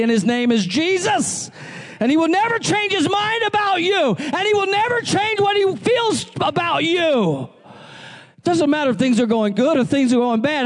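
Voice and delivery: high, fervent sermon climb; rising gospel preaching. Acted. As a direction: preach it hard, calling the church to answer. Preaching at full force.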